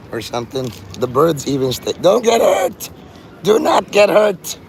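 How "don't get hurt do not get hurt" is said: put-on louder, higher yell like a cranky old man. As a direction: shout it after the kids like a grumpy old man. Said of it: "like an old man yelling at kids".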